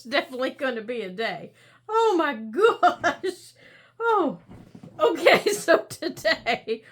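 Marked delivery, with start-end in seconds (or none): 1.9-6.8 slow, louder, laughing exclamation. Real, not acted. Laughing fit winding down.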